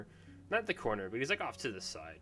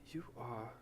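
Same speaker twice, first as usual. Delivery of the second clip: gentle, fond talk to a small creature; soft doting voice; 4 semitones higher, 4 dB quieter than usual. Acted. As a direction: coo at the little guy like a pet lover. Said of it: "and he goes".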